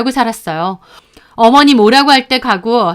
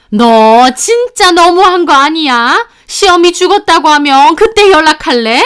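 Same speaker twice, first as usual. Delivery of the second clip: mother-in-law yelling; 6 semitones higher, 6 dB louder than usual. Retold or imitated. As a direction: yell at the daughter-in-law. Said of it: "하면서 소리를 지르시더라고요". (며느리에게 소리 지르는 시어머니, 높고 큰 고함)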